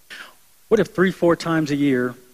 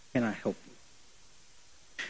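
soft offer; 3 semitones lower, 14 dB quieter, much slower than usual. Retold or imitated. Gentle offer to crying person.

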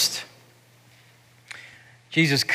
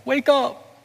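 put-on much higher pleading child's cry, rising each time; a child's voice climbing higher with each wake up; desperate boy crying; 11 semitones higher, slower than usual. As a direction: beg a fallen father to wake. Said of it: "Ricky Schroder just starts yelling".

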